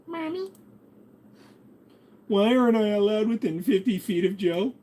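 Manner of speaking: very high put-on kid voice; little child. Child asking mommy about Joe, high kid voice. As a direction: pipe it up like a child asking mom.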